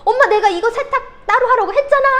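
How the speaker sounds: high, full-voiced scolding shout at mom; acted angry yelling. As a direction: yell the complaint like a fed-up daughter. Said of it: "엄마한테 소리를 칩니다". (엄마한테 짜증 내며 고함치는 연기)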